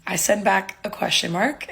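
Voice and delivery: normal talking voice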